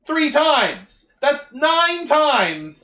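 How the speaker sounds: high, loud tally with drawn-out emphasis; mock outraged yell. Podcaster counting up the team's failures at them, mock outraged. Acted rant scolding incompetent team.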